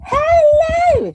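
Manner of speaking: high, loud sing-song hello; chanting a chat greeting up high and loud. Retold or imitated. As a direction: call out a bright high hello. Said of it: "Kick-Ass Kiani said".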